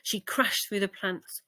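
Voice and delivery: usual speaking voice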